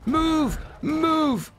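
high, urgent order; urgent command. Real, not acted. Player to stuck mount at the rock, urgent cry. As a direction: urge it on, pitched well up.

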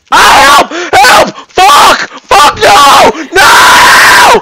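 high screaming cries for help; real terror, all-out shrieking; panicked scream. Real, not acted. Getting knifed, screaming.

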